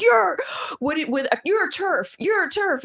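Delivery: accusing yell. Retold or imitated. Imitating people who call her a terf, mock accusing yell. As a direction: fling the accusation like an angry online critic. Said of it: "it's like".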